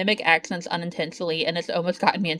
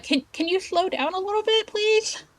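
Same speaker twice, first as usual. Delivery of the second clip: much higher, softer pleading voice; pleading customer request; 11 semitones higher, 4 dB quieter than usual. Retold or imitated. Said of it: "And I'm always like".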